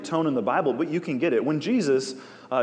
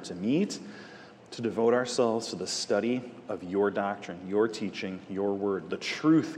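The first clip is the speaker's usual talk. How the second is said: low, hushed earnest prayer to the Father; 3 semitones lower than usual. Acted. Teacher praying over the class, hushed and earnest. Opening prayer before the study.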